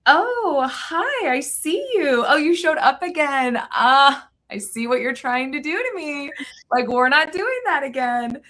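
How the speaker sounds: put-on high, bubbly Valley Girl lilt. Host greeting the voice of doubt in a Valley Girl accent. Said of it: "what I call my Valley Girl voice".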